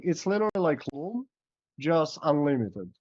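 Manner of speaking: usual speaking voice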